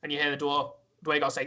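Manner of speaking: usual speaking voice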